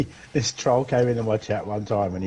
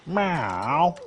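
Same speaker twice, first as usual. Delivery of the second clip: mock meow; 6 semitones higher than usual. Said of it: "She's just sitting there going".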